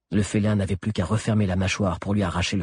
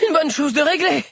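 much higher-pitched character voice; out-of-breath remark; 4 dB louder than usual. A narrator's reading. Sabi running down the mountain with friends, high-pitched remark. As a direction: pitch it right up while running downhill. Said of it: "soufflait Sabi".

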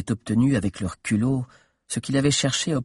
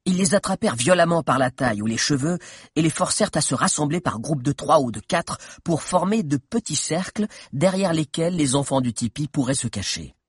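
ordinary delivery

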